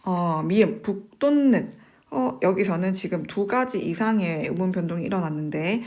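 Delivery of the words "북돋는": 북돋는 slowly as 북똔는, higher pitched; tense the first ㄷ of 북돋는 and nasalize the second to n; correct pronunciation. Shown as a demonstration. Teacher reading out an item for students, drawn-out 북똔는. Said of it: "그것도 맞는 발음입니다".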